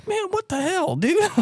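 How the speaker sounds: high falsetto protest; incredulous, very high-pitched complaint; mock exasperation. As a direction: imitate a baffled man squeaking back. Acted wizard's exasperated reply.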